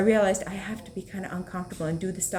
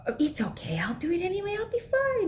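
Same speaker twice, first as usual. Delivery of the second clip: put-on high, breezy kid voice, higher each line; confident little boy; 12 semitones higher than usual. Mother imitating her son, a high, carefree confident kid. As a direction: play the five-year-old, carefree and sure. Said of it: "He was so sure".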